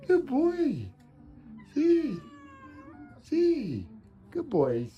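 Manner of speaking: high, loud pet praise; doting dog talk, high and loud; praising a pet. Real, not acted.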